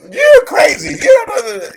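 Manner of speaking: high, loud crowd mockery; ridiculing crowd. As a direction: jeer at him like a scoffing mourner. Mocking crowd ridiculing Jesus.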